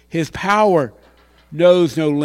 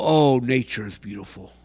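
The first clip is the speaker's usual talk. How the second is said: mild mock admiration; 8 semitones lower, 6 dB quieter than usual. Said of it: "don't go".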